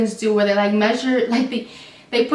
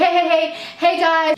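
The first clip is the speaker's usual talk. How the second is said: higher-pitched mimicked call across a shop; raised-pitch interjection, acted; retold calling out; 5 semitones higher than usual. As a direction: imitate a sister calling out to break it up. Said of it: "His sister's at the register like".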